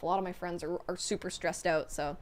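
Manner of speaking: usual speaking voice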